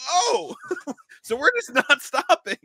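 high, slow, laughing disbelief. Retold or imitated. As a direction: squeak it out through laughter, half in shock. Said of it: "I'm like".

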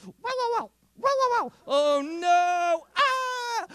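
hammed-up high alarm; mock panic. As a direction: yelp like the attack is landing. Dad mock-wincing at attack.

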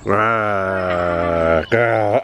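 playful mock monster growl, lightly done; a dad's hammed-up roar for his son; Gruffalo growl. Dad growling like the Gruffalo for his toddler, playful mock roar. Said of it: "Growl like a Gruffalo".

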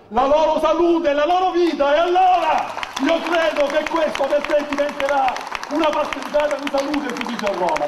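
high, loud rally climax; rally shout. Real, not acted. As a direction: proclaim it to the crowd.

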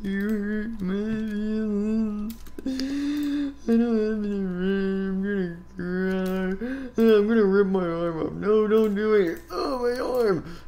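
high, slow, whining; whiny hurt voice. Acted. Hurt stranger whines at the one who insulted him, high and slow. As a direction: whimper each word out slowly.

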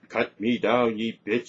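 loud, outraged demand; furious Irish maid, loud; angry shriek. A narrator's reading. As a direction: shriek it in fury, loudly. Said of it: "shrieked Brenna".

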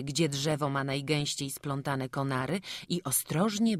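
ordinary delivery

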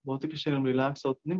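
even, unhurried relaxation voice with long pauses; guided meditation. Acted. Guide listeners to relax from shoulders to feet, guided meditation. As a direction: ease them into rest, one body part at a time.

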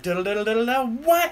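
scatted riff climbing to a high mock cry; imitated excited scream. Imitating the guitarist as the band crescendos, joyful mock scream. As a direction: mimic a jamming guitarist, pitch leaping up on the last word. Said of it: "you hear him, like, screaming".

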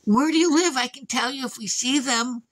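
high sweet lying voice; fake-innocent old lady. Old lady lying to the dad, high and sweet. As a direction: play innocent. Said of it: "She goes".

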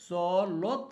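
চলৎ Shakti stretched very slowly; slow dictation. Shown as a demonstration. Teacher showing the exam word, slowly drawn out. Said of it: "পরীক্ষার মধ্যে দেওয়া থাকবে".

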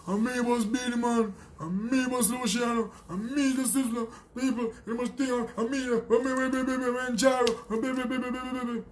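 mocking high-pitched blubbering impression; mock whining crybaby. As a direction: mimic a crybaby's complaint, rattling into "me, me, me". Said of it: "Stop crying like a little bitch".

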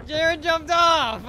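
shouting out in surprise; loud, slow, high alarm; surprised shout. Real, not acted. Sudden alarm in game.

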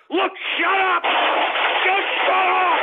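high, loud yelling, the last words dragged out slowly; furious shout. Real, not acted. Host screaming at troll viewers to shut up, furious.

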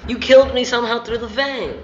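high, loud complaint; indignant protest. Real, not acted. Player killed through the vent accusing friend, indignant protest. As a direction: push the pitch up and complain loudly.

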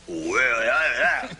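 loud, drawn-out bear bellow; imitated bear roar. Guest showing host how the bears sounded, imitated bear roar. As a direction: growl out a noise like the bear. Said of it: "That's how they sounded".

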